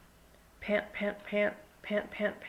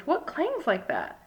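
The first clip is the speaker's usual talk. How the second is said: slow, high-pitched exclaimed question; a drawn-out, high exclamation of wonder; exclaiming; 6 semitones higher than usual. A narrator's reading. Big Bird hears something outside, exclaiming. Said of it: "Big Bird exclaimed".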